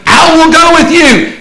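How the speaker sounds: high, loud eager promise; eager volunteer. Retold or imitated. Preacher voicing the eager man pledging to follow Jesus. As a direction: burst out with eager zeal.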